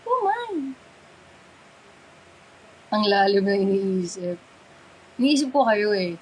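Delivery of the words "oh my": high-pitched little exclamation; squeaky high exclamation. Acted. Same exclamation said two ways.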